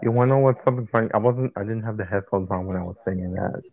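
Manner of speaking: normal talking voice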